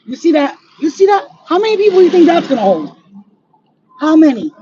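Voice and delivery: loud, high, indignant pointing out; real outrage, voice up and loud; outraged exclamation. Real, not acted. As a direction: shout it in disbelief at the sight. Pointing at new buildings, outraged.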